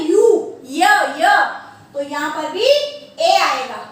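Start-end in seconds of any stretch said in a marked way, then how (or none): 0.1-1.3 यू broken down to य, "yuh"; isolated Y sound. Shown as a demonstration. Teacher explaining uniform, यू and य sounded out loudly. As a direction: sound out यू, then य, loud and separate. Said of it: "कैसे प्रोनाउंसिएट कर रहे हैं".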